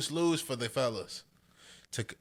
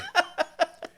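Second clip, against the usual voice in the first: laugh; 4 dB louder than usual. Real, not acted.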